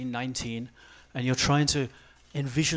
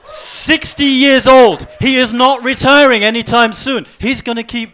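excited shout; 9 semitones higher, 14 dB louder than usual. Real, not acted. Excited point about Putin's age.

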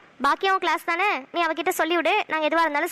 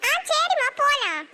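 put-on much higher, small child speaking to her mother; a little girl, sniffly and meek; little girl's voice; 9 semitones higher, slower than usual. Small daughter answering her mother, in a high child's voice.

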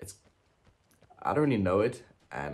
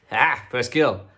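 triumphant shout; 5 semitones higher, 13 dB louder, slower than usual. Real, not acted. First kill triumph.

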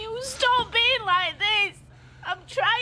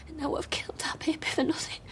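fast, softer whispered confession; 2 semitones lower, 6 dB quieter, faster than usual. Acted. Woman confessing to her partner in a whisper. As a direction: barely voice it.

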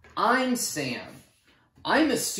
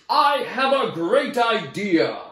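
higher, louder, slow, thrilled announcement; exclaimed; 7 semitones higher, 8 dB louder, slower than usual. Acted. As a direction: burst out with the big idea. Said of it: "exclaimed Kansas".

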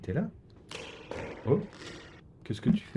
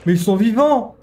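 scared horror game streamer; 7 semitones higher, 8 dB louder than usual. Real, not acted. Startled by monsters.

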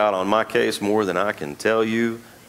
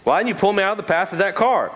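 high, insistent indignant demand; demanding woman; 11 semitones higher than usual. Acted. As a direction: she confronts God, outraged she was cheated. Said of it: "she demanded".

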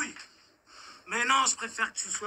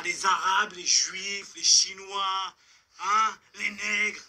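slow, louder, each word hit; hateful listing; 3 dB louder, slower than usual. Acted. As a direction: spit out each group slowly. Agresseur énumère avec haine. (énumération lente et haineuse)

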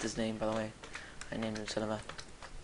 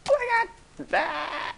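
very high, loud shriek; high-pitched startled screaming; panicked shriek; 14 semitones higher, 5 dB louder than usual. Real, not acted. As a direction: squeal in sudden panic. Panicking at danger in game.

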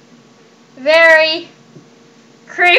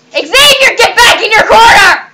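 higher, louder yell at the pet; angry shout; 6 semitones higher, 6 dB louder than usual. Real, not acted. Owner yelling at Xavier to go to the corner, shouting. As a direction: bellow the order across the room.

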